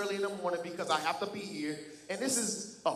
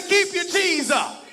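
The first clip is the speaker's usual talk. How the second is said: even louder, higher, slow shouted chant; shouted louder after he asks for more; 11 semitones higher, 11 dB louder, slower than usual. Real, not acted. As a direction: belt it for the back row. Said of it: "I need it louder".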